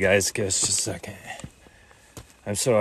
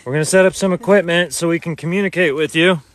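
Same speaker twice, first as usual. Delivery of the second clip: calling out; 7 semitones higher, 5 dB louder than usual. Acted. Addressing spirits in the cemetery.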